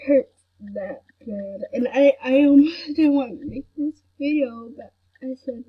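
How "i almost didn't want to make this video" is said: ordinary talk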